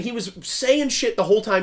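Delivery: usual speaking voice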